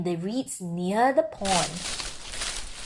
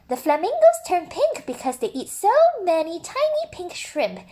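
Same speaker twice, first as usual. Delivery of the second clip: higher, brighter-pitched zookeeper stopping him firmly; higher zookeeper voice setting a rule; 9 semitones higher than usual. Acted. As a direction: play the zookeeper, a firm higher voice.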